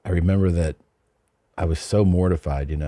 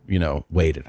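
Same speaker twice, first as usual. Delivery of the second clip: normal talking voice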